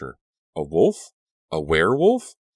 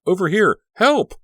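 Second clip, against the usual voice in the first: very high, loud calls; shouting; 8 dB louder than usual. A narrator's reading. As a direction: flag down the van. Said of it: "she shouted".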